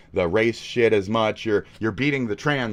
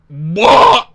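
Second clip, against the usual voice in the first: mock wail; 10 semitones higher, 14 dB louder than usual. Mocking Asmon's whining.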